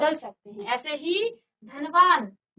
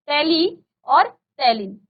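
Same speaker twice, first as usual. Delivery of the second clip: usual speaking voice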